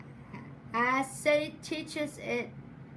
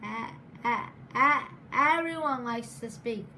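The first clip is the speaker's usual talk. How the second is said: repeating the first sound; real stutter on the opening word; 2 semitones lower than usual. Real, not acted. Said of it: "but I stutter".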